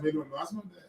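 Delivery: muttering a private realization; hushed inner thought. Retold or imitated. Telling friends, hushed private thought.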